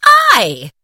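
loud indignant spirit; cried out. Acted. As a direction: shout it in offended disbelief. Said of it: "cried the spirit".

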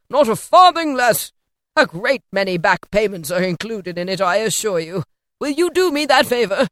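put-on loud, animated old benefactor; effusive Scrooge, insistent. A narrator's reading. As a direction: press a gift on him eagerly.

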